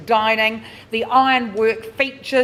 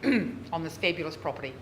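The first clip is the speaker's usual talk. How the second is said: normal talking voice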